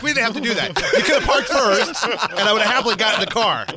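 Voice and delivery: husband laughing at her parking drama. Retold or imitated. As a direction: laugh your way through the complaint. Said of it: "I'm like".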